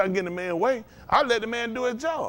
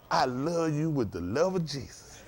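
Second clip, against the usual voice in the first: soft and slow, syrupy sincere; sweetly sincere; 5 semitones lower, 8 dB quieter, slower than usual. Shown as a demonstration. Preacher showing how to answer a hater, soft and slow. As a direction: lay it on gently so they believe you. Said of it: "You gotta make 'em think".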